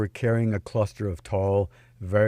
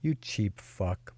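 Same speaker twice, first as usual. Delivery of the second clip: slow, soft insult in a lightly put-on Russian accent; not-so-sexy accent; 7 dB quieter, much slower than usual. A narrator's reading. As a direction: drag it out quietly, Russian-accented. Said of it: "in a not-so-sexy accent said".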